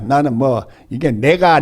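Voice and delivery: usual speaking voice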